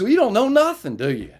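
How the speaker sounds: mocking officer.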